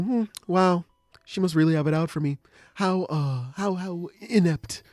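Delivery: put-on coolness, slowing down on the insult; feigned nonchalance. Boy acting above it after being yelled at, feigned nonchalance. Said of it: "trying to play nonchalant".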